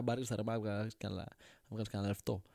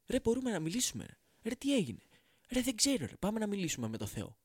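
much higher, astonished caveman voice; excited caveman; 9 semitones higher than usual. Acted. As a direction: squeal with disbelief that you can talk. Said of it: "του λέει". (πολύ ψηλή, ενθουσιασμένη φωνή του Κώστα)

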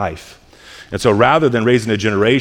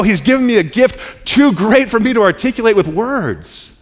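full-force preaching; 7 semitones higher than usual. Acted. Pastor preaching the cross to the congregation, fervent. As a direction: preach it with rising fire.

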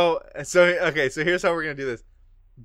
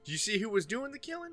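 high, softer, quick lawman voice; questioning lawman; 9 semitones higher, 9 dB quieter, faster than usual. Acted. Lawman asking witnesses about the killer, questioning.

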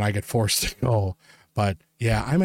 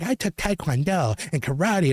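higher-pitched mock kid voice; boastful kid; 6 semitones higher than usual. Kid introduces himself to the adult, boastful mimicry.

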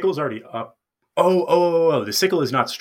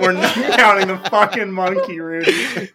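laughing; 7 semitones higher, 5 dB louder than usual. Real, not acted. Player laughing at the monkey.

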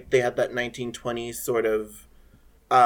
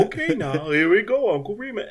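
mock director's cue; 8 semitones higher than usual. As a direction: call out the take start like an eager director. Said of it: "it was like".

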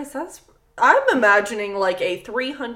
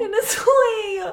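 high, slow, tearful-sounding wail; whimpering, stretched-out dismay; plaintive lament; 11 semitones higher, 3 dB louder, much slower than usual. Real, not acted. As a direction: draw it out in pity. Lamenting the bear's fate.

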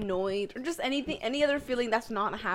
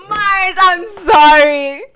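laughing apology; 3 semitones higher, 15 dB louder than usual. Real, not acted.

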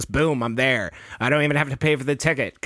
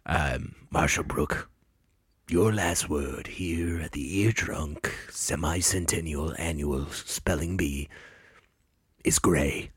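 low, slow, formal pronouncer voice; spelling bee announcer; 10 semitones lower, 7 dB quieter, slower than usual. Pretend. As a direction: announce the word like an official.

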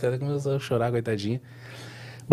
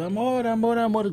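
high, raised mock cry of amor, amor; retold scream; 9 semitones higher, slower than usual. Pregnant wife screaming for her husband, mock panicked cry. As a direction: call out amor, amor in alarm, pitch jumping up. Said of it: "um griteiro".

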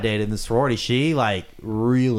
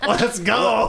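laughing taunt; 11 semitones higher, 3 dB louder than usual. Real, not acted. Laughing, ready to fight.

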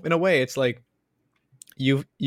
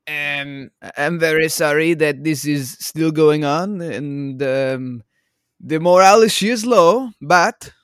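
louder, higher mock French accent; bad French accent; 3 semitones higher, 11 dB louder, slower than usual. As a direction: play a sorry officer in a hammed-up French accent. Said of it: "I can't even do a French accent".

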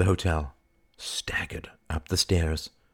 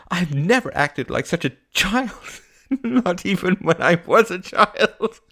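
high, strained words broken by laughing; high brittle laughter in the voice; 12 semitones higher, 5 dB louder than usual. Acted. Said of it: "she said with a brittle laugh".

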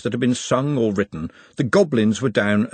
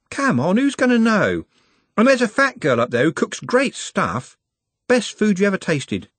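higher-pitched, easy-going persuasion; Trev, coaxing lad; 10 semitones higher than usual. A narrator's reading. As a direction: coax a shy mate along.

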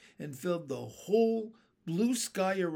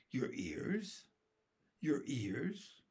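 soft, questioning echo; hesitant, baffled boy; puzzled; 5 dB quieter, slower than usual. A narrator's reading. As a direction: repeat it quietly in bewilderment.

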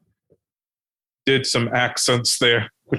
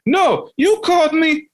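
insisting driver. Retold or imitated. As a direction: cut in hard as the sure driver.

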